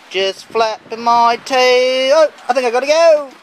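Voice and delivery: high, loud voice for the dog. Pretend. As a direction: speak for the pet dog, high and eager. Owner voicing dog by the grill.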